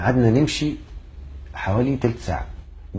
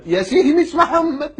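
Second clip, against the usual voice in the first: drawn-out, very high-pitched correction; comic outburst at the wrong name; exasperated mock protest; 4 dB louder, slower than usual. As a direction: stretch it out high and slow. Protests wrong name once more.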